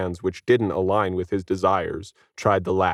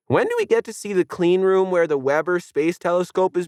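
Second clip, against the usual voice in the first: put-on much higher pestering kid voice; bratty kid; 10 semitones higher than usual. A narrator's reading. Kid pestering the guide again, bratty. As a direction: nag like a know-it-all kid.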